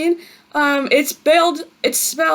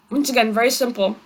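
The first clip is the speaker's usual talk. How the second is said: usual speaking voice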